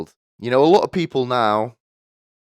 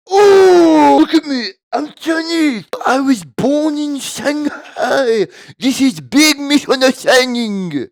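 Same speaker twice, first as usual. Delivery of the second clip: much higher, louder, slow, cartoonish fake Chinese accent; 11 dB louder, slower than usual. Mocking the caught spy pretending to be Chinese, crude mock Chinese accent. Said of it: "putting on a, a really offensive accent".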